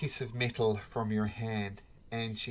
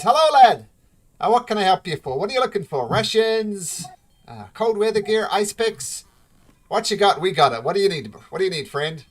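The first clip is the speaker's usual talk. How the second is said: higher, louder hearty dwarf woman; gruff dwarf shopkeeper; 9 semitones higher, 11 dB louder than usual. Acted. Dwarf shopkeeper welcoming a customer, hearty and loud. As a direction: greet the customer like a bustling shopkeeper. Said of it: "And says".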